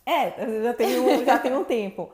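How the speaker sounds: amused admission, laughing. Real, not acted. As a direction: laugh through the reply. Laughing reply.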